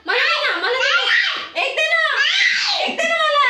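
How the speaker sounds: high, loud laughing shouted pleading; playful begging. Pretend. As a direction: beg the child in fun, laughing. खेळात मुलाकडे मागणे. (खोट्या लडिवाळ हट्टाने हसत ओरडून मागणे)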